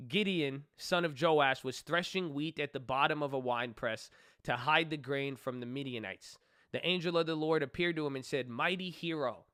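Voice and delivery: reading Judges aloud to viewers, in a measured reading voice; scripture reading. Acted. Reading the Bible story aloud to viewers in a steady voice.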